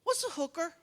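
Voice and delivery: put-on high innocent child; kid voice. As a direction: ask it like a curious little boy. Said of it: "and he goes".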